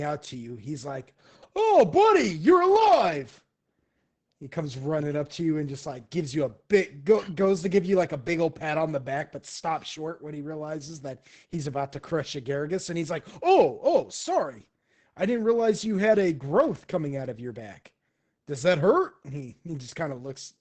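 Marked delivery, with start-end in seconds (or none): 1.5-3.4 very loud, high-pitched whoop; big boisterous welcome; overjoyed greeting. Acted. Kung Po runs out to greet friend. 13.4-19.2 startled apology, loud and high; animated, loud concern; boisterous, good-natured mercenary. Acted.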